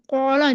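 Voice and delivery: "कौला" with over-rounded lips, vowel turning to "aw"; हद से मोटा. Shown as a demonstration. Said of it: "इतना मोटा होंठों को गोल करके". ("कौला" में होंठ गोल करके वाव जैसी आवाज़)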